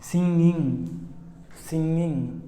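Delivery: सिंगिंग with nasal ng, no ga; correct ng. Shown as a demonstration. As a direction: model सिंगिंग. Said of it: "इसका सही उच्चारण ऐसे होगा".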